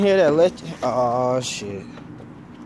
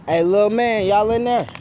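louder, higher call into the dark; 7 semitones higher, 5 dB louder than usual. Real, not acted. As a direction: call out to someone inside. Calling out into building.

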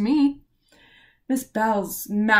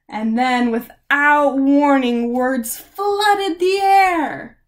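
louder, slow and higher; rising drama; 3 semitones higher, 7 dB louder, much slower than usual. A narrator's reading. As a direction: build it up grandly. Narrator builds to climax.

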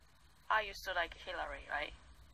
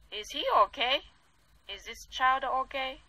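worried plea; 6 semitones higher, 7 dB louder than usual. Real, not acted. Worried about the abused boy.

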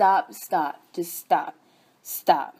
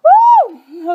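very high and loud whoop; real overflowing excitement; ecstatic woo; 12 semitones higher, 14 dB louder than usual. Real, not acted. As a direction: let out a joyful holler. Catching the spirit, shouting out.

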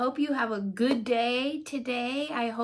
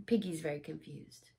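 ordinary delivery

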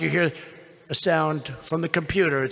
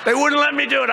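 high, loud repeat of "wouldn't"; hammed-up indignant complaint; mock outrage; 9 semitones higher, 5 dB louder, faster than usual. Real, not acted. Complaining the medal was refused, loud mock outrage.

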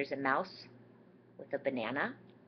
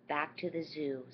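plain reading voice

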